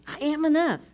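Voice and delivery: high, loud declaration; defiant. Retold or imitated. Answering the inner critic, defiant and loud. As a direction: claim it proudly. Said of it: "And I say".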